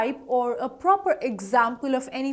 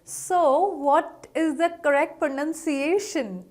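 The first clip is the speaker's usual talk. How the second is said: normal talking voice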